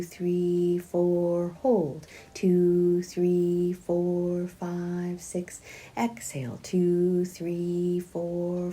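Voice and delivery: steady, drawn-out counting of breaths; a calm, measured yoga breath count. Acted. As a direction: count the breaths out slowly for the class.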